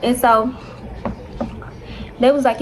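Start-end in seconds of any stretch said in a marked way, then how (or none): none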